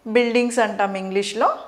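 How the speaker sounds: ordinary delivery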